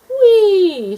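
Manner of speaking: high loud whee; gleeful yell. A narrator's reading. Gator rolls on skateboard, high gleeful yell. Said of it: "she yelled".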